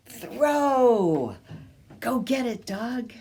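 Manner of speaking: excited pretend throw; playful call. Pretend throwing ball for dog.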